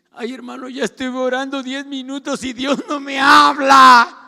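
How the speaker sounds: high, hammed-up wail rising to a shout; mock complaining believer. Preacher mimics believers tired of praying, mock high complaint. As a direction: whine it like an impatient churchgoer. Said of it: "¿Cómo dicen?".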